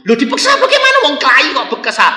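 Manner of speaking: high, fast scolding teacher's voice; mock the teacher scolding him. Teacher scolds boy in story. (guru menegur dengan suara tinggi)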